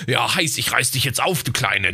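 low sleazy pick-up voice; mock lecherous growl at a pitch drop; sleazy come-on. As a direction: play a lightly sleazy flirt, voice dropped. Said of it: "nach dem Motto".

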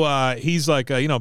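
ordinary talk

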